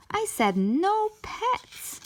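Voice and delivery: high, slow, scolding; angry yell. A narrator's reading. Mom scolding child about pets, slow high anger. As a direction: spell out the rule like a fed-up mom. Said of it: "yelled Mom".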